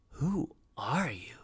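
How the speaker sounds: slow, hushed question; bewildered question. A narrator's reading. Unc to the mysterious man, slow soft bewilderment. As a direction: ask softly, drawing each word out.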